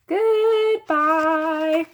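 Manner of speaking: high drawn-out goodbye; calling out. A narrator's reading. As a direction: call it across the house. Fudge still calling from doorway.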